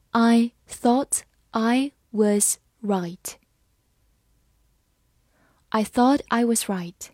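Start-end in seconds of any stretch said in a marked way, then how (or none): 0.1-3.3 slow, word by word; slow reading. Shown as a demonstration. Teacher reading slowly for students, slow and clear. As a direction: read it slowly for learners. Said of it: "好，我们慢慢来读。".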